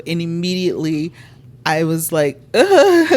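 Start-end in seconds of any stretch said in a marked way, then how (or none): 2.5-3.2 high, loud groan with a laugh at the edge; an exasperated ugh cracking into laughter; groan that turns to laughter. Retold or imitated. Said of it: "The sound that came out of my mouth".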